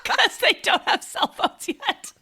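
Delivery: talking through laughter; high, laughing it out. Real, not acted. Laughing at daughter's joke.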